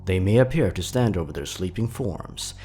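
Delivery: usual speaking voice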